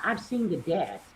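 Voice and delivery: usual speaking voice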